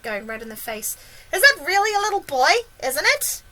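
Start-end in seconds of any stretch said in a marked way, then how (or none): 1.3-3.4 high-pitched, incredulous question; astonished cry. A narrator's reading. Fire chief doubts his eyes, crying out. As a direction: ask it in amazement. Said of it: "cried the head".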